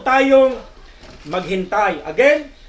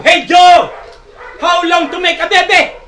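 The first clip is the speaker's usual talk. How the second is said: impatient yell, much higher and louder; retold shout; 9 semitones higher, 10 dB louder than usual. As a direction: bellow the question at the conductor. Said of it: "Kaya siya po'y sumigaw".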